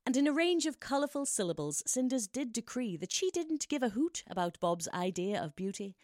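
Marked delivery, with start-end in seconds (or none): none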